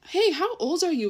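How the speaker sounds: imitating a suspicious double-take; taken-aback question. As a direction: retell it as a startled, pointed question. Retold question to young girl.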